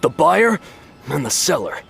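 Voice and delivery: high, combative rant at Paimon; defiant rant. Acted. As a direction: push back hard, indignant. Buyer argues with Paimon.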